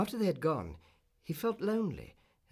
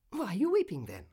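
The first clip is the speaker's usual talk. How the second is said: high, louder bird's question; little swallow; 7 semitones higher, 5 dB louder than usual. A narrator's reading. Swallow to the weeping Prince, high puzzled question. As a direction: ask it pitched up, as the small bird. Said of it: "asked the swallow".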